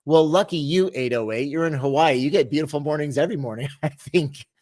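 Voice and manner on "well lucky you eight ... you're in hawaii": ordinary talk, nothing marked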